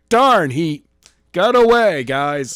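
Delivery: loud, hammed-up fake dismay; Daniels fake disappointment. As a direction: overplay a fake "darn". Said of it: "Daniels is like".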